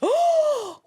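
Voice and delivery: a sudden, high, startled gasp; sharp gasp. Real, not acted. Host gasps at the murdered woman's request, sharp startled gasp. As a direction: gasp in shock at the twist. Reacting to ghost story twist.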